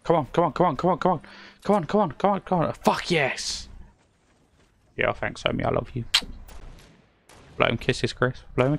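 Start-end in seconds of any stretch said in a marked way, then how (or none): 0.0-3.7 higher, rapid-fire chanting into triumph; will it on, repeating urgently; urgent urging. Real, not acted. Willing on a win in the game, excited.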